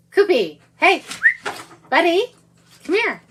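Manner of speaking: high, loud call to a dog. Real, not acted. Calling the dog.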